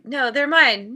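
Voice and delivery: loud mock squabble; mine, said like a kid grabbing a toy; childish possessive squabble. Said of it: "And it's like".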